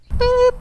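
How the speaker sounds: high loud startled beep; surprised beep. Real, not acted. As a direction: blurt a sudden high beep. Sudden interruption.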